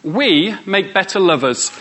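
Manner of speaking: usual speaking voice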